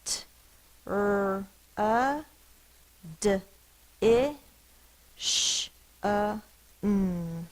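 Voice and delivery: each phoneme of "tradition" said alone, with pauses; isolated phonics sounds, one at a time. Shown as a demonstration. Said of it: "Let's do the sounds".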